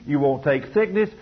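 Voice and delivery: normal talking voice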